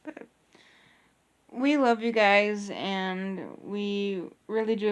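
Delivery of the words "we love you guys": normal talking voice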